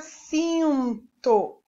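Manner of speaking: "cinto" split, nasal "cin" held long; sounding out syllables. Shown as a demonstration. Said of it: "Eu quero escrever agora". ("cinto" silabado, com o "cin" prolongado)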